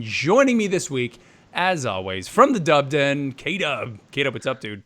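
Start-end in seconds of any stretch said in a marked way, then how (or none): none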